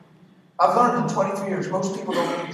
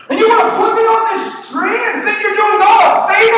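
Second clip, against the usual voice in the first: screaming, high and loud, outraged; furious shout; 16 dB louder than usual. Acted. Preacher voicing Jesus raging at the crowd, full shout. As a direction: rage at the crowd like a wronged man.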